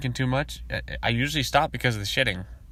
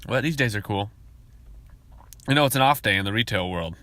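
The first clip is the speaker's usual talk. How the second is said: usual speaking voice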